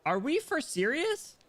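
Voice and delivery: high-pitched, indignant disbelief; incredulous outrage. Real, not acted. Streamer ranting at a character forgiving the bully, incredulous. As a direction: go high, as if you can't believe your ears.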